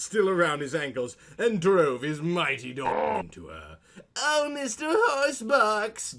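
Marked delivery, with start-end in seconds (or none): 4.2-6.2 much higher, slow drawn-out mock cry; hammed-up scream. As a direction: stretch his name out in a high mock cry. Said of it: "Diana screamed".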